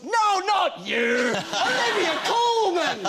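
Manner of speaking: high, drawn-out mock shout; excited fan shouting. Actor imitating the old fan correcting him, excited high shout. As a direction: cry out each word slowly, very high.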